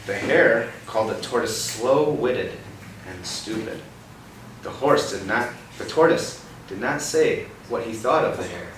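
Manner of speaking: dramatic bedtime-story reading; storybook reading. Acted. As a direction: spell out each phrase for a child. Reading picture book to child.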